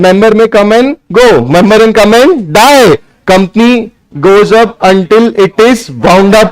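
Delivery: slow, word by word for note taking; dictating pace; slow dictation. Shown as a demonstration. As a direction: spell it out slowly so they can write. Dictating to students.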